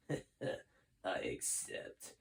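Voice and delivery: slow, sinister whisper; villain accepting command, whispered; evil whisper. Acted. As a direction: breathe it with villainous glee. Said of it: "grinned Sheldon evilly".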